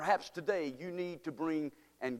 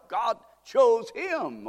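slow, loud and higher; preaching emphasis; 7 semitones higher, 6 dB louder, much slower than usual. Acted. Preacher insisting to the church, slow loud emphasis. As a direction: drag out each word for weight. Preacher stresses God's choice.

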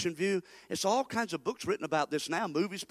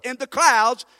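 louder, rising preacher call; preaching loud; 5 semitones higher, 11 dB louder, slower than usual. Real, not acted. Preacher exhorting his congregation, loud and high. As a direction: lift in the clouds up loud.

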